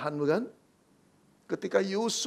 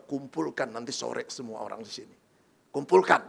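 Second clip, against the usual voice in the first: mild anger; 7 semitones lower than usual. Acted. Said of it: "papanya mulai naik darah".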